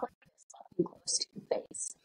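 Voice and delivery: imitated in-your-face whisper; hushed, voiceless demonstration of close talking. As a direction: whisper it right up close to the listener. Son talks right in faces.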